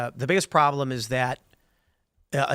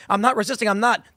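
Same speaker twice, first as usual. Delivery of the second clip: mock protest, pitched up; 6 semitones higher than usual. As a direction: plead innocence to the robot cop in a higher voice. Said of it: "And you're like".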